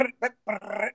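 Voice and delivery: rapid rolled brr bursts; imitated machine-gun fire. Man recounting gunfire in the Colombian hills, imitated machine-gun bursts. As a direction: mimic distant gunfire with the lips. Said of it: "literally machine gun fire that you hear".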